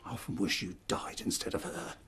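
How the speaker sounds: low, hushed and cruel; cold quiet cruelty. Acted. Father's cruel low remark.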